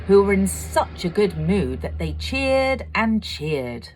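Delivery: ordinary delivery, nothing marked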